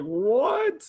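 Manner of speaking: high-pitched, drawn-out disbelief; mock-stunned, pitch leaping right up; incredulous. Acts out disbelief at the scene.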